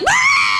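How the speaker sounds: a short, high, loud scream of fright; sudden shrieking "Ah!"; startled scream. Retold or imitated. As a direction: yelp as the rat pops out. All screaming at rat in toilet.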